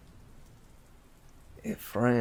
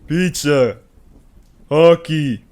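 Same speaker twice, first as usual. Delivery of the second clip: higher, very loud brute shouts; dim brute shouts; 6 semitones higher, 13 dB louder, slower than usual. A narrator's reading. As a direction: bellow each word like a proud oaf. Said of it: "Cal offered".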